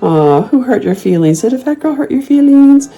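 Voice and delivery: put-on baby-talk a hurt troll; mock sympathy. Teasing a troll with high fake pity. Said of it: "I'll be like".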